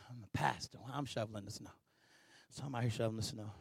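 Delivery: low, very soft self-talk; muttered inner grumble. Retold or imitated. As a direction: grumble under your breath to yourself. Voices his grumbling inner thoughts.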